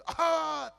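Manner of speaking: loud hack aimed at the glass; throat clear toward window. Retold or imitated. Uncle turning to the glass to hack, loud. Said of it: "તે કાચ બાજુ કે".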